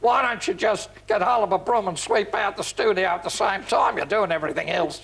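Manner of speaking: imitated broad Australian grumble; [name]'s Australian accent. Director ribbing the one-man studio, mock Australian accent. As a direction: put on an Australian accent, teasing. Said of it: "who was Australian".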